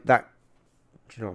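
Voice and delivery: ordinary delivery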